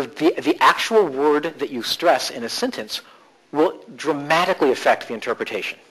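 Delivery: ordinary talk, nothing marked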